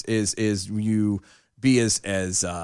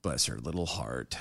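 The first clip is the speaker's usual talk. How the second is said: sarcastic murmur; 13 semitones lower, 13 dB quieter than usual. Acted. Sarcastic southern aside.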